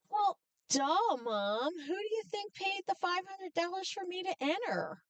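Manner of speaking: high-pitched mock exasperation; indignant retort. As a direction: imitate a sister stating the obvious to her mom. Shocked sister retorts to mother.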